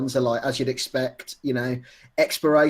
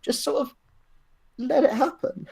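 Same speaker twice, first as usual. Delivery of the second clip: quoting a friend; 9 semitones higher than usual. Retold or imitated. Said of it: "He was just like".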